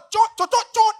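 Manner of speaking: rapid high-pitched pecking kiss sounds; playful string of squeaky "cot" kisses; mimed kissing noises. Retold or imitated. Imitates repeated kisses on father-in-law.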